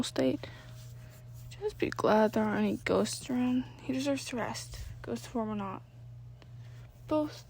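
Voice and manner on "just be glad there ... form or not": much softer gentle voice; hushed. Acted. Sam speaking softly over sleeping Danny.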